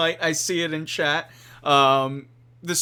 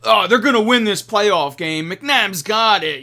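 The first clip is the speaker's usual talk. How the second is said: confident fan; 6 semitones higher, 7 dB louder than usual. Retold or imitated. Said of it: "I've learned that oftentimes".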